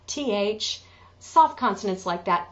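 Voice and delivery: usual speaking voice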